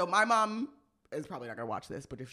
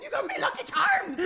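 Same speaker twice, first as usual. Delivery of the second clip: leprechaun voice; 5 dB louder than usual. Retold or imitated. Mocking leprechaun voice.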